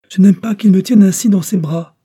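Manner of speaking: high, loud tearful wail; crying out. Acted. Little girl held by the captain cries out in tears. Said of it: "poussa un cri et fondit en larmes".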